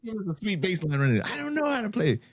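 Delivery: high cartoon kid voice; imitating Token. Quoting a cartoon kid to friends, high and giddy. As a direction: pitch it way up, thrilled by the bass line. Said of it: "He's like".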